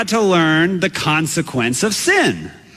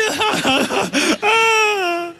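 mock wail; 13 semitones higher than usual. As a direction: let the howl trail on. Continued wailing after the yell.

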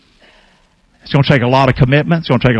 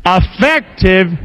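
slow, higher Effective; stronger repeat; 4 semitones higher, much slower than usual. Shown as a demonstration. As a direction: say Effective with more force. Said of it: "you sound weak on it".

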